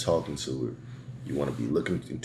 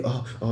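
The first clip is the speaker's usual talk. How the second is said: mock moan; 8 semitones higher than usual. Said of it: "women will be".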